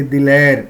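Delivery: دلیر said as "dilair", with an e vowel, not ee; majhool e vowel. Shown as a demonstration. Teacher giving a ya majhool example, دلیر with e, not ee. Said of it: "یا مجہول کہتے ہیں".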